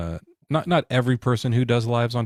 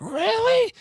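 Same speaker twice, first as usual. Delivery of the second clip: much higher, disbelieving really; incredulous. Retold or imitated. Viewer learning God is the sponsor, squeaky disbelief. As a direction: pop up into stunned disbelief. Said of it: "then you're like".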